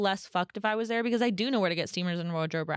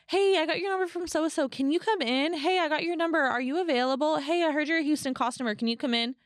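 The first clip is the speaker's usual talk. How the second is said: mock eager texters; 8 semitones higher, 3 dB louder than usual. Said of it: "text message from more people".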